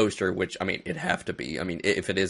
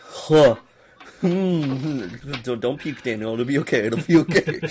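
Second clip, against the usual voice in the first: mock queasy self-talk; 3 semitones higher, 4 dB louder than usual. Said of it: "I actually went".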